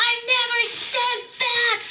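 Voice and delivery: very high, loud angry outburst; exploding in anger. Retold or imitated. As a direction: blow up from zero to a thousand. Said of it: "he just exploded".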